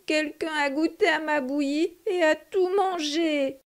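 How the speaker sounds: a hint of a little bear whining; whiny baby bear. A narrator's reading. Baby bear finds his porridge all eaten, whining. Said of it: "pleurnicha bébé ours de sa toute petite voix".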